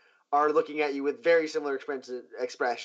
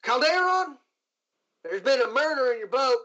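higher, louder lawwoman breaking bad news; a marshal reporting a killing to the ship's owner; 10 semitones higher, 3 dB louder than usual. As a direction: announce the murder up high and firm. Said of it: "And she goes".

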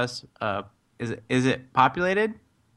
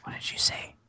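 whisper; 9 dB quieter than usual. Acted. Player whispers back.

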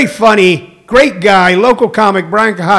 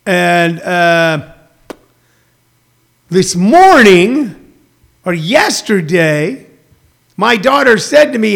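ordinary talk

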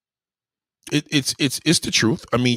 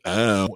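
half-hearted "ow" catcall; weak, unremarkable "ow"; no personality. Shown as a demonstration. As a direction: toss out the "ow" without conviction. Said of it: "you can't say that".

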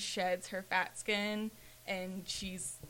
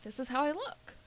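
higher, rising affirmation; self-accepting, not resigned; 5 semitones higher, 3 dB quieter than usual. Shown as a demonstration. Showing friends full acceptance of your looks, affirming. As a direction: state it with acceptance, lifting the pitch. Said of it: "not in a, like, a resigned way".

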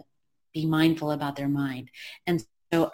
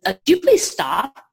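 louder, pleading audience member; an audience member begs the speaker to stop, louder; mild mock plea; 5 semitones higher, 8 dB louder than usual. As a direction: call out asking him to stop. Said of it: "somebody in the audience says".

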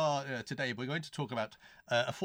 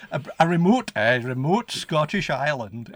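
loud, hammed-up Scottish blurb reading; Scottish accent; 2 semitones higher, 10 dB louder than usual. Reading the notes aloud in loud mock Scottish. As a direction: announce it in a broad Scottish burr.

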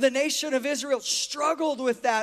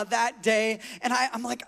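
ordinary talk, nothing marked

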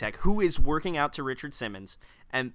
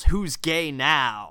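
slow, louder yell through a laugh; retold yell; 4 semitones higher, 7 dB louder, much slower than usual. Cohost retelling the groom's outburst on the bed, laughing yell. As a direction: yell it drawn out, half laughing. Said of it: "that he yells".

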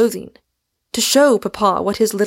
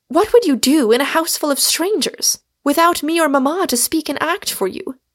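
higher-pitched, doubting sister's voice; protesting older sister; 6 semitones higher than usual. A narrator's reading. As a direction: challenge your little sister in a raised pitch.

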